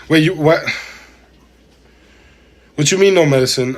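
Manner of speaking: startled disbelief, high and loud; surprised. Real, not acted. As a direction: react like you misheard. Reacting to chat saying no medicine.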